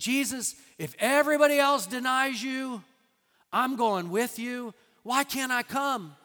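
loud, high, insistent vow; fervent pleading. Retold or imitated. Peter begging Jesus to let him come along, fervent and loud. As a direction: pledge loyalty at the top of the voice.

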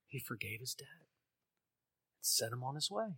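soft hushed whisper; awed whisper of grace. Real, not acted. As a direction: breathe it as a whispered revelation. Dramatic reveal of the forgiveness.

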